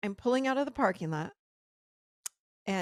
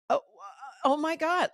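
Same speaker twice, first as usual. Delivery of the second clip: horrified; 8 semitones higher, 6 dB louder than usual. Retold or imitated. Horrified at lung news.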